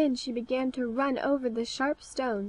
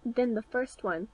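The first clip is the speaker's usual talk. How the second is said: usual speaking voice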